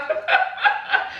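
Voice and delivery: breathy high giggle. Real, not acted. Laughing at comment.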